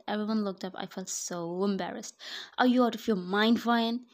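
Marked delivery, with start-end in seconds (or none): 2.6-4.1 loud furious yell at her; angry yell. A narrator's reading. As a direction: tear into her for the mistake. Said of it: "he yelled again".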